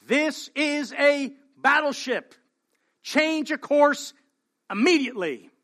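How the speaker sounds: an outraged captain demanding obedience; angry captain yelling over the radio; furious captain. Retold or imitated. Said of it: "the captain is furious".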